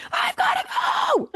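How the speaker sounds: a sudden high, loud shout; pretend shout. Imagining yelling at a new houseguest while rushing off, a high loud shout. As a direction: blurt it out like you're bolting out the door.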